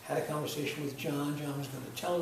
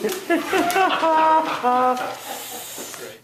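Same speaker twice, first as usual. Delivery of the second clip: loud laugh; 13 semitones higher, 14 dB louder than usual. Acted. After announcer line.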